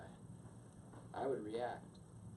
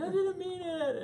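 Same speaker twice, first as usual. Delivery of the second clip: much higher, louder sheepish plea; mock apology; 7 dB louder than usual. Wife after the slap, high sheepish apology. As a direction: say sorry sheepishly.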